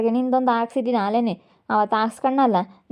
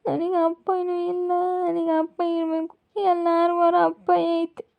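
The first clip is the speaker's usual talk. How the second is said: high, slow, crying voice; high wavering child-like sob; tearful whimper; 7 semitones higher than usual. Acted. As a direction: cry for the father you never had. Son crying for his father.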